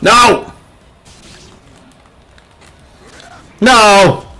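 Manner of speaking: sudden high "No!" of dismay; shocked cry. Real, not acted. Gamer yells at the screen as the match slips away, shocked. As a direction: yelp at the screen in real shock. Reacting to game loss.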